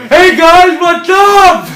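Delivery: loud, high, goofy shark greeting; friendly shark voice. Acted. Big shark to floating castaways, friendly shark voice. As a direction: boom it out as a chummy giant shark.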